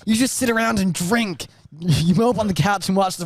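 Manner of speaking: put-on high, laughing teenage son; whiny teen. Teen son defending his night visits, high and giggly. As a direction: pitch it up like a sulky teen. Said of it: "basic American accents".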